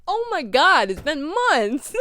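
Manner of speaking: laughing through the words. Real, not acted. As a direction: giggle while saying it. Laughing at own delay.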